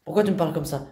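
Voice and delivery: normal talking voice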